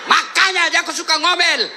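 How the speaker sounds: high, laughing quip; wife's excuse. Retold or imitated. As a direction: play the wife justifying nagging. (istri yang membela diri sambil tertawa)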